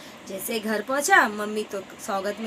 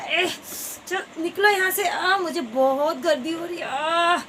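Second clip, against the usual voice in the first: pushy shove; 6 semitones higher than usual. Acted. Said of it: "ऐसे करने लगा".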